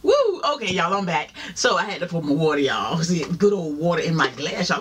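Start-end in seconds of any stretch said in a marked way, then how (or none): 0.0-0.4 excited whoo. Real, not acted. After drinking cold water.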